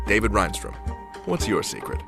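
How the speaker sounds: ordinary delivery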